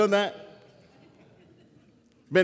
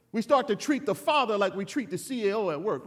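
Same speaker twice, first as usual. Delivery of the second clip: ordinary talk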